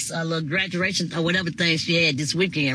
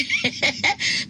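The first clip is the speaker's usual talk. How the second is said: short high giggle; laugh; 13 semitones higher than usual. Real, not acted. Laughing after misreading a comment.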